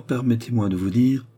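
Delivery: plain reading voice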